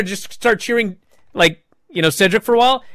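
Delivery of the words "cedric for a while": ordinary delivery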